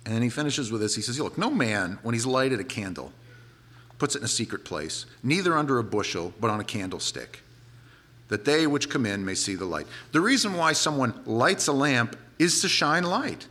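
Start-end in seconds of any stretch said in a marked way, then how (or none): 1.2-9.9 lower, measured King James recitation; a preacher quoting Jesus, solemn scripture reading. Acted.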